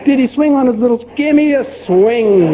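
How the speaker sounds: eager salesman. Acted. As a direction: lean in hopefully with the next sale. Owner pushes yet another gadget.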